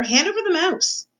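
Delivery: high-pitched demand without much volume; mock shout. A narrator's reading. Another rat demanding the mouse, high mock shout. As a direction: squeal the demand, pitch climbing. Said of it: "shouted another".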